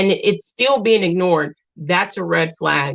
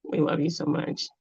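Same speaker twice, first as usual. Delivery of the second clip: soft; 3 semitones lower, 10 dB quieter than usual. Real, not acted. Reading a loving viewer comment.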